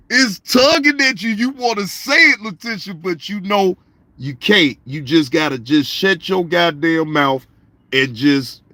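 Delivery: ordinary talk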